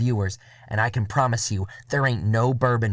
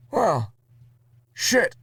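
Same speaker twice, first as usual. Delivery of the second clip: Murray's voice; 9 semitones higher than usual. Acted. Murray gives up on a plan, high character voice. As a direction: go way up for Murray's deadpan curse.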